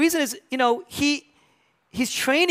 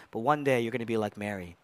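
ordinary talk

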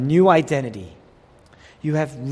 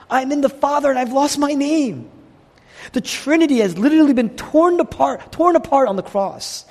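rising preaching cry; 10 semitones higher, 4 dB louder than usual. Acted. Climax of preaching on Trinity.

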